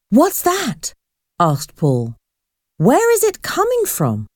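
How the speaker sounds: high, alarmed questions; startled questioning. A narrator's reading. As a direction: ask in high-pitched alarm at a sudden sound. Alarmed at a sudden loud noise.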